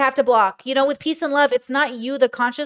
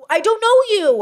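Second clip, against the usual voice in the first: higher, louder, exasperated cry on "know"; exasperated outburst; 8 semitones higher, 4 dB louder than usual. Acted. Creator venting at a pushy near-stranger, loud exasperation. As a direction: throw up your hands and hit "know".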